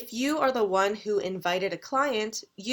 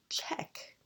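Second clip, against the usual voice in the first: just shape "Check." silently for the waiter across the room; silent mouthing; 11 dB quieter than usual. Shown as a demonstration. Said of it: "make a silent gesture and move your mouth".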